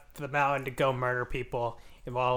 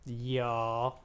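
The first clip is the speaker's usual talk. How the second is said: country bumpkin; 5 dB quieter than usual. Shown as a demonstration. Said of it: "learning to talk like a country bumpkin".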